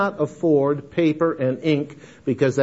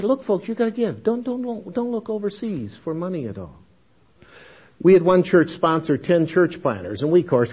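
ordinary delivery

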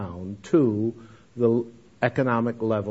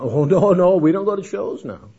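eager denial; 6 semitones higher, 7 dB louder than usual. Retold or imitated. Convert denies eagerly.

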